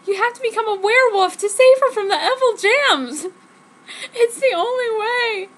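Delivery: high, loud crying plea; tearful plea. Acted. Jenny crying as she begs Jacob to save her. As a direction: plead through tears.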